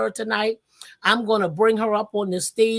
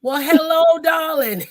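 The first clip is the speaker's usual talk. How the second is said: playful, high and loud greeting; breathy vintage purr; 7 semitones higher, 6 dB louder than usual. Acted. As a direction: sing out a showy, flirty welcome. Host greets guest onto stage.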